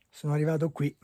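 ordinary delivery

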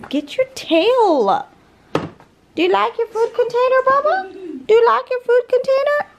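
very high squeaky coo. Real, not acted. Mom asking the dog about its new feeder in squeaky high baby talk. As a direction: ask the dog up high and sweet.

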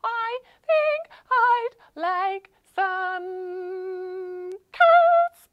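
high squeaky chicken voice; chicken puppet voice. Acted. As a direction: ask like a shy little chicken.

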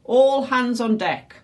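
loud, high, drawn-out command; shouted order. A narrator's reading. Flynn orders all the pirates up, calling out. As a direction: call the crew up slowly. Captain orders crew to deck.

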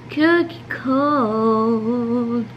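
cookie cold, very slowly stretched; drawn out. Shown as a demonstration. Girl repeating a phrase, stretched very slowly.

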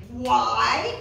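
ordinary delivery

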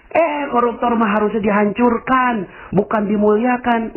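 high-pitched mock protest; indignant objection. Listener insists corruptors deserve ruin, indignant.

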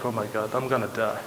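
low, very quiet, rushed aside; mock inner dread. Brother facing a red-eyed gangster, mock inner dread. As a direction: mutter it fast to yourself as if doomed.

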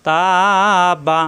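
long held "তা", then a short "বা"; one-alif madd. Shown as a demonstration. Teacher demonstrates madd, long "তা" and short "বা". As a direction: stretch the vowel of "তা", keep "বা" brief. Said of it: "এখানে এক আলিফ টান হবে".

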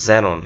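"জেনন" starting with z, ze-non; correct pronunciation. Shown as a demonstration. Teacher offering the right option, correct pronunciation. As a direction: say "জেনন" with z, falling. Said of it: "তাহলে এটা কি".

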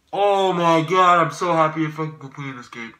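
hammed-up exasperation; exasperated outburst. Real, not acted. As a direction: cry out to the heavens. Exasperated with quiz.

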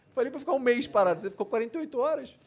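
loud, high-pitched exasperated telling-off; retold scolding. Doctor retelling how he scolded his patient, exasperated. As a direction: re-enact an exasperated reprimand, pushed loud and high.

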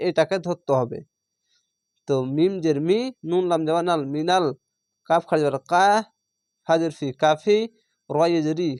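usual speaking voice

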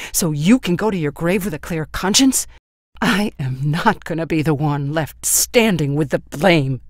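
loud, hard, relentless tirade; furious. A narrator's reading. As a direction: hammer her with each line. Son's furious tirade continues.

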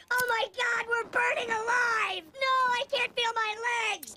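high, loud screaming in agony; burning scream. Acted. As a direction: scream like you're on fire. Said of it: "your family's screaming".

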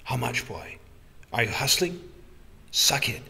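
put-on shaky, hoarse old man whisper; hoarse trembling whisper. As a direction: breathe it out, trembling and rasping. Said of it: "His hoarse, tremulous whisper".

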